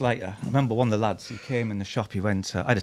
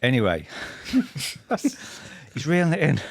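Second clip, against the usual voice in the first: ordinary talk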